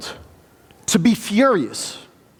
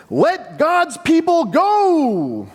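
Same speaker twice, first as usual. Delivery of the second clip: higher, slow, drawn-out proclamation; booming Moses command; 8 semitones higher, much slower than usual. Acted. Moses commanding Egypt's leader, slow booming proclamation. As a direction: proclaim it slowly like a prophet. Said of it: "I'm guessing that's how it sounded".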